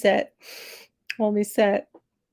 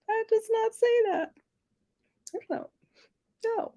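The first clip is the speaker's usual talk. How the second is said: playfully incredulous, pitched way up; mock indignant protest; 4 dB quieter than usual. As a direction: protest in a much higher voice. Mock argument over pronunciation.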